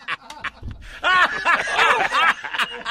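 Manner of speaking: long, loud, high laughing fit; hysterical laughter. Real, not acted. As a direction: laugh till you lose it. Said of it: "I literally was losing my shit".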